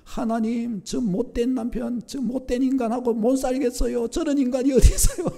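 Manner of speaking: high, rising into a loud outburst about the husband; wife's prayer turns into an angry rant; indignant complaint. Retold or imitated. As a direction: vent at God about the rotten husband. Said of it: "순식간에 기도가 바뀌었대요".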